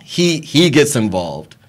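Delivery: ordinary talk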